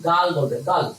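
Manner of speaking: "গার্ল" said "gaal", R silent; British pronunciation. Shown as a demonstration. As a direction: leave the R out of "গার্ল". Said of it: "এটাকে বলবে তারা কি".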